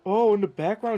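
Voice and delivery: loud, high, excited call; startled exclamation. Real, not acted. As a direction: shout out a sudden sighting mid-commentary. Spots a sudden mishap behind.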